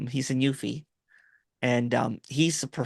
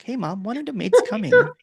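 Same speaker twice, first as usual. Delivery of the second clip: put-on spoiled kid; 12 semitones higher, 7 dB louder than usual. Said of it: "we're like".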